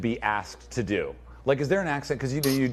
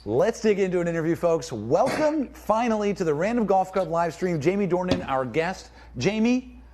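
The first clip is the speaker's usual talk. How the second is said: higher-pitched, upbeat announcer welcome; show host intro; 7 semitones higher than usual. Acted. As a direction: kick off the livestream and welcome the guest. Host formally opens livestream interview.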